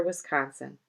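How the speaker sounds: ordinary delivery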